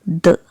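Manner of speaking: short "the" said as "thuh"; weak form of the. Shown as a demonstration. Teacher models the before a consonant, short "thuh". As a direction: say "the" with a brief schwa vowel. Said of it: "it is pronounced as".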